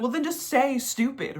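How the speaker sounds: high-pitched, pointed comeback; exasperated retort. Retold or imitated. Retort to a friend dodging the word, mock exasperated.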